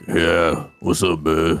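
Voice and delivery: deep, lightly put-on dude voice; a hint of a tough guy greeting; macho bro voice. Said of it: "I find it cringe when dudes are like".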